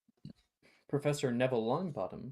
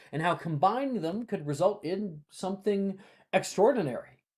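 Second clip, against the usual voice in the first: a lightly raised, upbeat teacher voice; cheerful professor; 6 semitones higher, 5 dB louder than usual. Acted. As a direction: coax the groaning class along kindly. GM voicing Neville to class.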